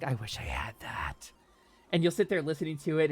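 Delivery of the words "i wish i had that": a much lower, softer, slow sigh of envy; wistful longing. Retold or imitated. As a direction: let the wish ache out quietly.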